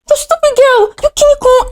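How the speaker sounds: high mock yell at the girl; senior yelling. Senior scolding the accused little girl, high mock yell. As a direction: shriek it like the bullying senior. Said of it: "this senior was".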